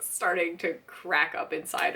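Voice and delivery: ordinary delivery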